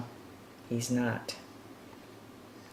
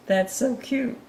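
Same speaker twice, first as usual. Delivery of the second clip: much higher, cooing over something cute; cooing delight; 11 semitones higher than usual. Real, not acted. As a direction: coo at the cute picture then laugh.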